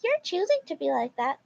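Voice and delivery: high put-on insistent mom; entitled mom. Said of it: "Titled Mom".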